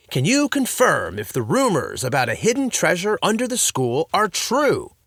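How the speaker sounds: loud, grand question; dramatic dad. A narrator's reading. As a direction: ham up the big reveal.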